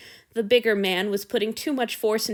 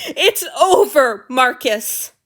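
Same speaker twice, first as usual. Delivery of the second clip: loud, slow, furious yell; angry shout; 3 semitones higher, 9 dB louder, slower than usual. Acted. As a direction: throw it at him. Breakup shouted.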